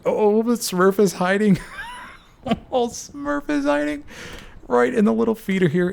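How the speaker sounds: high, laughing excitement; gleeful call of the hiding player; delighted. Real, not acted. Caster showing viewers Smurf hiding, gleeful excitement.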